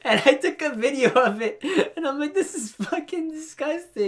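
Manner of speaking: high-pitched, loud, helpless laughter through words; laughing hard. Real, not acted. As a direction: barely get the story out for laughing. Cracks up at the loogie story.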